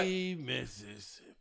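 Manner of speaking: slow, drawn-out country Mississippi count; old country Mississippi. Shown as a demonstration. Demonstrating to friends how Mississippians count, old country accent. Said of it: "You gotta do an old country Mississippi".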